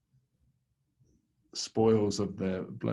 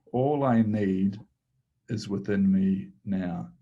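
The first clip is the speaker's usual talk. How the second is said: reciting an incantation; 5 dB louder than usual. Shown as a demonstration. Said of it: "this one just seems to, seems to work".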